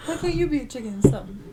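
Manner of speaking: high, loud playful outrage; mock offended. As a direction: squeal it in fake offence at the friend. Called a chicken goose, mock offence.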